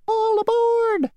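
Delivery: very high, slow squeaky call; a tiny mosquito calling his passengers aboard. Acted. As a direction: sing it out like a tiny ferryman. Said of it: "called the mosquito".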